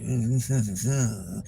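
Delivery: low, sleepy morning hum; a hint of a sleepy man's hum. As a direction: lightly mimic a groggy mm-hmm. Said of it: "it's this morning voice".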